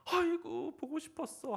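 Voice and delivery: hammed-up joyful greeting. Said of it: "그 집사님이 그러면".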